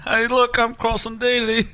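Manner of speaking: high, slow mock British drawl; a high, slow put-on British accent for a TV host; mock Carson Daly. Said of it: "that British accent".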